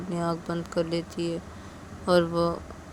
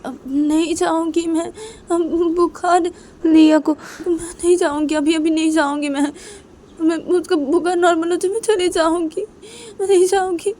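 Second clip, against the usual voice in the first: high, loud protest; insistent refusal; 11 semitones higher, 10 dB louder than usual. Acted. Rui refusing to leave a feverish child, loud protest. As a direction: cry out a stubborn plea to stay.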